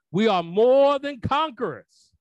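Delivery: high soaring triumphant cry; preaching at full force. Acted. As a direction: shout victory to the church. Preacher peaks.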